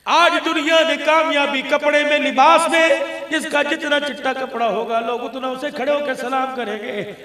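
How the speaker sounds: loud, declaiming sermon voice; forceful pulpit denunciation; full-force preaching. Real, not acted. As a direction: declaim loudly to the crowd, then ease off.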